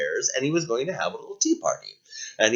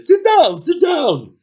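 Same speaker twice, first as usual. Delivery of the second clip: much higher, louder sit down; eager host; 8 dB louder, slower than usual. Acted. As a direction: insist happily. Dragon urging guest to sit.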